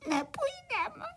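put-on high, sobbing, stuttering child voice; tearful little crickets. A narrator's reading. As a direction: whimper in fright, dragging out the end. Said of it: "malí cvrčci rozplakali".

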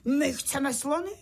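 put-on high, insistent child-like clamour; high-pitched cry, only lightly shouted; mild shouted demand. A narrator's reading. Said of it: "Tak začal křičet".

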